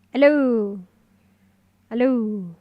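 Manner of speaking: loud, falling hellos into the mic; loud mic test hellos. Shown as a demonstration. Streamer testing her in-game mic, calling out loud hellos. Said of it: "if I talk in the game".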